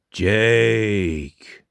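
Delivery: long, drawn-out ghostly call; tomb-like ghost. A narrator's reading. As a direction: intone it like a voice from the tomb. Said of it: "said the ghost in a tomb-like voice".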